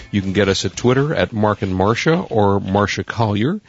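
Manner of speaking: ordinary talk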